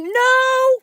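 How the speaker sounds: high, loud retold "no"; screaming. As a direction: shriek it out in protest. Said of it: "I'm actually screaming right now".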